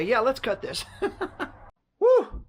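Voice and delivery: ordinary talk, nothing marked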